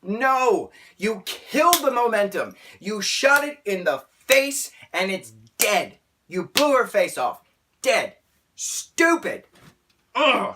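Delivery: loud, high outraged outburst; worked-up, indignant yelling; angry rant. Real, not acted. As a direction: punch each phrase in exasperated fury. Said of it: "I'm angry. Clearly, I'm angry".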